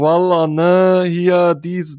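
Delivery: put-on loud, slow, brash drunk boasting; imitating a loud drunk bachelor-party lad; drunk lads yelling. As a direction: ham up a rowdy drunk guy. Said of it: "so richtig schon rumgebrüllt".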